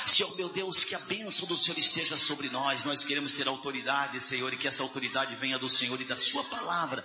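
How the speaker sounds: fervent, half-sung prayer swelling to cries; closing prayer. Acted. Pastor praying aloud over the standing congregation, fervent sung prayer. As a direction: pray over the church, rising in fervour.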